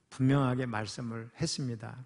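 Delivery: ordinary delivery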